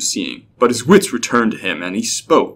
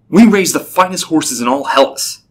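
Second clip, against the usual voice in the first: higher, proud boast; indignant; 6 semitones higher, 4 dB louder than usual. A narrator's reading. Entrant defends his horses to the clerk, indignant. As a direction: boast as if insulted. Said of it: "he said indignantly".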